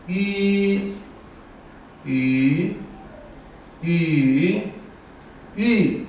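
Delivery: walk 吁 through the four tones. Shown as a demonstration. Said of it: "我们用声调来读".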